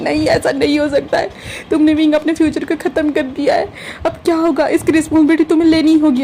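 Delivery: high, crying mock lament; theatrical crying complaint; hammed-up wail. A narrator's reading. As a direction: comically sob about the damage. Wangji wails after being kicked.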